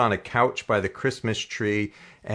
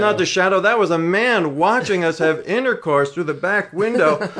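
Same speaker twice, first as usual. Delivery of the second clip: excited telling; 6 semitones higher, 4 dB louder than usual. Real, not acted. Climax of story, discovered watcher.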